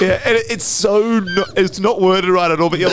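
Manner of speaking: laughing too hard to talk. Real, not acted. Laughing at the impression.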